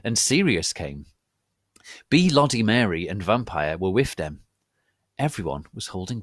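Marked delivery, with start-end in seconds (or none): none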